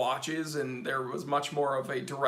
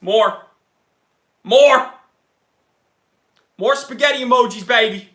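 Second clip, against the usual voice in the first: loud, building calls for more; hyped-up demand; 6 semitones higher, 12 dB louder than usual. Pretend. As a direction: work the crowd up, louder each time. Hyping chat, calling for more.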